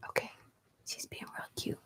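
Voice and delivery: hushed whisper so the cat stays asleep; barely voiced, very soft. Real, not acted. Not waking the sleeping cat.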